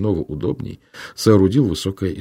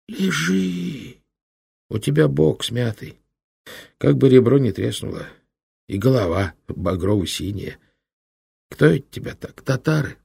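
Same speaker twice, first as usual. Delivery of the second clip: put-on a kindly old monk, a touch higher; old monk's voice; 4 semitones higher than usual. A narrator's reading. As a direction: speak as a gentle old hermit. (лёгкий голос доброго старого инока)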